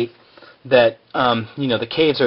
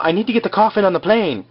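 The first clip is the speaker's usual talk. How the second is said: higher-pitched mock pleading quote; retold urgent plea; 7 semitones higher, faster than usual. Recapper imitating Jack at the ticket counter, mock pleading. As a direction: lightly mimic someone insisting, voice lifted.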